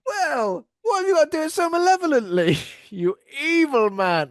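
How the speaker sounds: high, loud, fast mock scolding; squeaky, loud fake indignation; mock outrage. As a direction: pretend to be scandalised by the villain. Mock outrage at a guffing villain.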